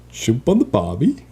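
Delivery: mock Aussie accent with rising pitch; laughing, hammed-up fake Australian accent; American faking Australian accent. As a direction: imitate a bad tourist attempt at Australian. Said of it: "American trying to sound like they're Australian".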